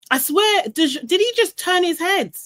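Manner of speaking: can't believe what she saw; incredulous. Real, not acted. Host recalling a contestant turning away, incredulous.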